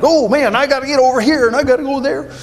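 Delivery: high, fast frazzled rush; busy Martha type. Retold or imitated. Pastor imitating a restless kid who can't sit still, high and fast. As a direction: rattle it off like you can't stop moving. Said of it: "And it's like".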